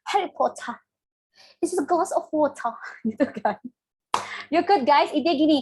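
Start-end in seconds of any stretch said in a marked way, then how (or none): 0.1-2.7 put-on British accent with dropped Rs. Shown as a demonstration. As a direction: demonstrate a fake British accent, lightly. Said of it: "kalau British like". (aksen British yang dibuat-buat)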